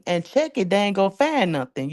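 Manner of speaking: ordinary talk, nothing marked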